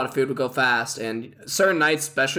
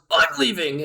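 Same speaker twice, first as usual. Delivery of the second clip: high-pitched mock storming out; angry outburst; 7 semitones higher than usual. Head chef quitting on his boss, mock angry outburst. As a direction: imitate a chef quitting in a huff.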